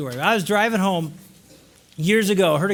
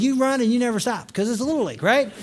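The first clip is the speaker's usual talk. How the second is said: ordinary talk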